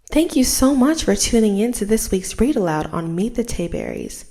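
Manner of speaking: loud, upbeat outro to viewers; host sign-off. Real, not acted. Host thanking viewers at the end, upbeat and loud. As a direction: sign off the show warmly to the audience.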